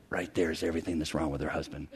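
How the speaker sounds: very low, hushed retold aside; imitating a hushed, confidential report; confidential whisper. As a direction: murmur it secretly into a colleague's ear. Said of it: "I whispered in his ear".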